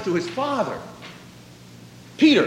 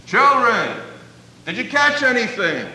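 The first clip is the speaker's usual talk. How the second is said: raised voice, pitched up, called out; Jesus calling to the disciples from the shore, raised voice; calling across water; 6 semitones higher, 3 dB louder than usual. Retold or imitated. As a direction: call out across the lake to the boat. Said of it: "he shouts across the water".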